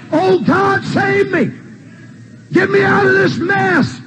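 high shouted plea for rescue; acted desperate cry. Sinner begging God to save them, high acted cry. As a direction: cry out to God at the top of the range. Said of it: "You cried".

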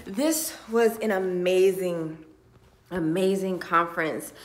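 retaking the line while fighting tears; tearful retake. Real, not acted. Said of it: "Let me".

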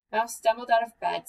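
plain reading voice

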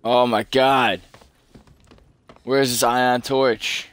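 venting at the game; exasperated. Real, not acted. Frustrated searching for torch.

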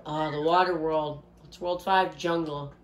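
usual speaking voice

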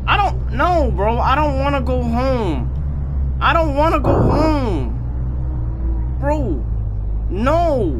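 frightened whine. Real, not acted. Gamer locked out and scared of Gerald, whining. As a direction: plead in real fright.

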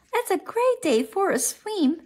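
mother frog's line. A narrator's reading. Mother frog suggesting a swim to the family, lightly voiced.

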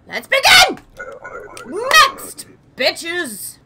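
high, loud cocky call; mock summons. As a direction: yell for the next in line. Calling the next traveller.

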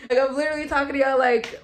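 giggling through the words; real amused laugh at her own voice; laughing at herself. Real, not acted.

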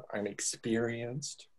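a hammed-up sultry voice for the joke; sultry mock. Shown as a demonstration. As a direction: purr it like a seductress.

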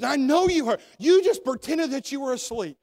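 hammed-up scolding wife; accusing wife. Wife scolding husband about the night.

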